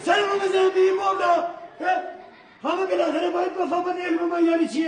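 ranting shout. Acted. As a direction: bellow your grievance. Doctor raging, shout heard.

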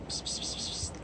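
soft unvoiced psst calling; whispered psst calls. Real, not acted. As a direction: hiss psst to call the pet.

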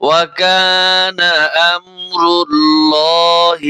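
very slow tajweed chant; Quran recitation. Shown as a demonstration. Teacher recites the verse for students, slowly chanted. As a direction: stretch every vowel in a reverent chant.

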